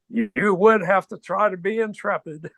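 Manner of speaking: light mock-grand booming delivery. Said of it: "He had this booming voice".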